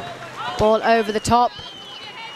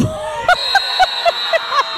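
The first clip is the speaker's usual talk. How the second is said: burst of laughter. Real, not acted. Big moment in the match.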